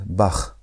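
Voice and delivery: "Bach" ending in throat friction, not K; German CH. Shown as a demonstration. Teacher gives an example of the sound, German CH. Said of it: "The C H sound".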